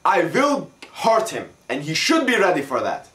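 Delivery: earnest foreign athlete voice; Russian accent. Acted. Mock-serious Russian player interview.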